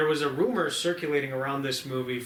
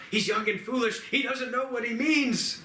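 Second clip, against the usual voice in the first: higher, begging servant ape; pleading ape; 8 semitones higher than usual. Retold or imitated. A servant ape begs his lord to spare the youngster, pleading. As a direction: beg for mercy up high. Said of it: "this other ape goes".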